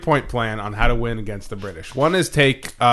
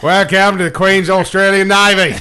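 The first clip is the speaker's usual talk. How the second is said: loud, high mock Australian officer; hammed-up Australian welcome, grinning; mock Australian navy officer welcoming captives; Australian accent; 6 semitones higher, 9 dB louder than usual. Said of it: "I went a little Australian with that".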